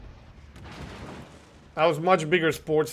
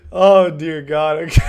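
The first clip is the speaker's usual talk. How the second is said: laughing; 7 dB louder than usual. Real, not acted. Cracking up at friend's joke.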